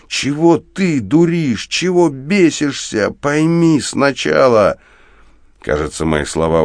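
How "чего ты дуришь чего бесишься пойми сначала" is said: high, slow, emphatic shouting; indignant anger. A narrator's reading. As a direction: demand that he see sense, voice pitched high. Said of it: "взрывает от возмущения и злости на него". (возмущённый протяжный крик)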